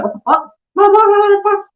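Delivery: shrill disbelieving shriek; shocked. Retold or imitated. Reacting to a woman refusing marriage, shocked shriek. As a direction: jump way up in outraged disbelief. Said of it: "I'd be like".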